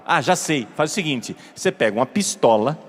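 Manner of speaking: ordinary talk, nothing marked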